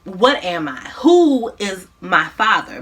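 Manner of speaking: ordinary delivery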